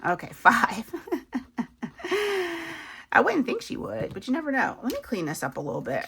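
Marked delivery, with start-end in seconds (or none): 1.0-3.1 a real laugh, much higher; high giggle after her joke. Real, not acted.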